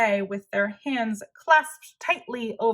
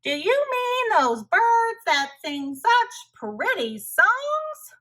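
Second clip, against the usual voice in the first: higher, louder goofy question; Tacky's voice; 11 semitones higher, 5 dB louder, slower than usual. Acted. Tacky teases the puzzled hunters, goofy and bright. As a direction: ask it like a cheerful clown.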